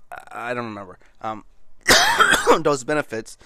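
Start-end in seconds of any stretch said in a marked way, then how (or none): 1.5-2.5 harsh dry coughs; hacking cough from a dry throat; coughing fit. Real, not acted. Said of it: "Sorry, I'm coughing a lot".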